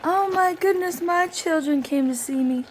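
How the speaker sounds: high, gushing delight; imagined delight. Pretend. Mother greeting her arriving children, gushing and high. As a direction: gush over the kids arriving. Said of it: "And I'm like".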